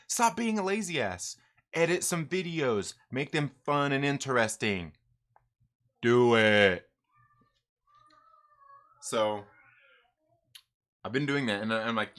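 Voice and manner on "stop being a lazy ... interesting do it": louder, forceful retold dressing-down; mock scolding. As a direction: lay down the law, building to a loud final order. Acts out his coach scolding him.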